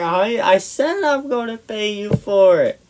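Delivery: high-pitched defensive insistence; retold protest under attack; exasperated retort. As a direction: whine it back, fed up.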